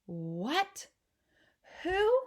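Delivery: drawn-out disbelief, leaping high and loud; stunned father, swooping up on the question; voice gone funny. Acted. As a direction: build to a high, incredulous yelp. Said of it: "his voice goes all funny".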